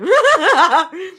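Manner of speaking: a high, loud real laugh. Real, not acted.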